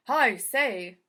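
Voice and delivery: loud call from the bathroom. Acted. Mister Rogers calling from the bathroom about the towels, loud. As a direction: call it out to the house. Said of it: "he called".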